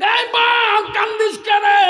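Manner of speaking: acted alarmed cry. Mother asks weeping son why, high alarmed cry. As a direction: call out in hammed-up alarm.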